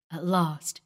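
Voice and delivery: ordinary delivery, nothing marked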